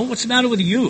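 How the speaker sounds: high-pitched, protesting comeback, short of a yell; defensive retort. Retold or imitated. Accused man answering his scolders, defensive protest.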